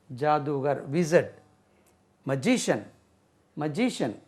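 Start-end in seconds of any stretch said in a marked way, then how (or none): none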